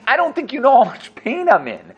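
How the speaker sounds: pained plea. Acted. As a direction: make the friend hear the pain. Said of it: "he's saying to him right now".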